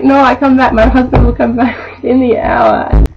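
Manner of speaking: imitating the customer, half laughing; mock customer in broken English. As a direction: stubbornly promise to return. Customer insisting she returns.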